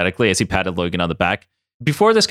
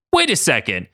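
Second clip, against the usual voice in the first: cried out; 10 semitones higher than usual. A narrator's reading. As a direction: realize it with a startled cry. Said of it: "Katie cried out".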